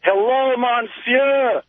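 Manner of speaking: high, drawn-out hello; hammed-up high-pitched monsieur; playful French greeting. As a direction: sing out a mock-French greeting. Caller playfully greets host in French.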